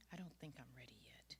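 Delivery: telling her son she is not ready; whispered resignation. Acted. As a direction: let it fall to a whisper. Quiet resigned end.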